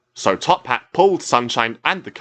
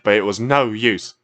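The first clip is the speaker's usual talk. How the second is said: ordinary delivery, nothing marked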